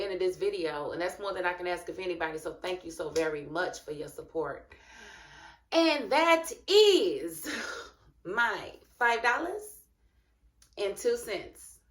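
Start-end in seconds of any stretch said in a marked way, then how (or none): 5.7-11.8 high, slow, sing-song "And that is", then easing down; drawn-out sign-off. Acted. Signing off to viewers with a drawn-out catchphrase. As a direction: stretch out the closing catchphrase.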